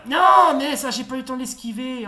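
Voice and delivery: loud, high cry easing into complaint; frustrated protest. Real, not acted. Player had no time to dodge, frustrated protest. Hit in the game.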